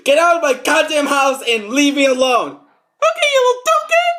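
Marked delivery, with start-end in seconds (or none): 2.5-4.1 very high, loud squeaky villain yell; squeaky cartoon robber. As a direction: screech the demand like a tiny crook. Acted quarrel, squeaky voice.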